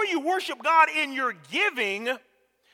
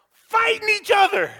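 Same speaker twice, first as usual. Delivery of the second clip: 1 exclaimed; 10 semitones higher, 5 dB louder than usual. Real, not acted. Kids fighting over the money.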